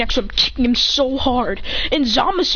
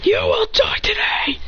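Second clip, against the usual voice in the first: slow, high whispered threat; menacing whisper; 11 semitones higher than usual. Acted. Fighter threatening Hit, slow whispered menace. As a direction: breathe the threat out. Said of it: "That's what he says to Hit".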